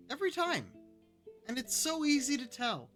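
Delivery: insisting loudly, worked up; excited. Real, not acted. Streamer insists, raised and excited.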